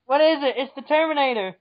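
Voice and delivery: loud, high dramatic cry; mock alarm. Joking that the Terminator is at the door, mock alarm. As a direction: announce the menace with hammy urgency. Joining the spoof alarm scene.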